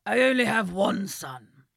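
slow, loud, gruff king; old, wise and a bit posh. Acted. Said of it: "The king answered".

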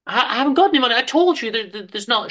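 terrified victim pleading with mugger; frightened pleading. Acted. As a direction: plead high and desperate.

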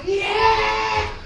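high, loud triumphant yell, stretched out; an Italian lad celebrating at full volume; excited shout. Retold or imitated. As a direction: jump up cheering about the mortgage. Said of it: "They can be somewhat charismatic".

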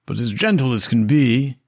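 ordinary talk, nothing marked

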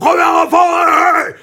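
imitating an outraged fan yell; fan shout. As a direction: blast it at the pitch. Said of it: "der er jeg liksom".

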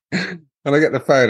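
ordinary delivery

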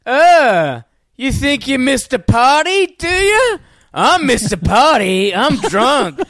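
put-on loud, high, slurring drunk bravado; drunk Ron Burgundy impression. Mate on the phone mocking him, loud high drunk boasting. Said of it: "it was like Ron Burgundy or so".

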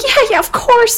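high, hurried-sounding brush-off; airy dismissal. Acted. Macbeth placating his worried friend, high dismissive assurance. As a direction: wave the warning away in a high voice.

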